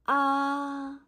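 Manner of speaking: level high Ah held long; first tone. Shown as a demonstration. Teacher demonstrating the first tone, flat and long. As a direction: hold Ah flat and steady at one pitch. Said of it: "First tone is a flat tone".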